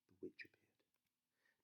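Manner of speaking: usual speaking voice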